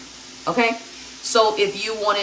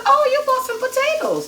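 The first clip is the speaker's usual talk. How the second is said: much higher, playful surprised question; mock surprise; 4 dB louder than usual. To her daughter about the potatoes, high mock surprise. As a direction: act delighted to see the potatoes.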